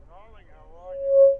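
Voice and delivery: high, slow sing-song "darling"; theatrical greeting. Real, not acted. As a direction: swoon the greeting like a diva. Caller greets hosts theatrically.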